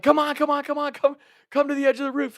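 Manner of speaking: high, loud calling across the yard. Retold or imitated. Friends in the yard calling to the roof, high and loud. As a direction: call up to someone on a roof.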